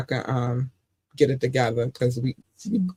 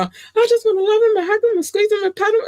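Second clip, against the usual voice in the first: very high, fast, squeaky gushing; high breathless cartoon-girl gush; Animaniacs little girl; 4 dB louder than usual. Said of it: "Don't I sound like her right now".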